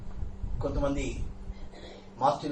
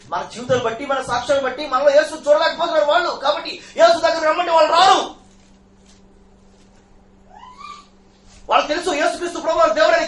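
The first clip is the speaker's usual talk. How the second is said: higher and louder, driving each phrase home; fiery preaching; 11 semitones higher, 9 dB louder than usual. Acted. Preacher rebuking his congregation, fiery rising shout.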